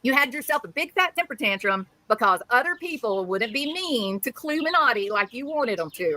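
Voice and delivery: normal talking voice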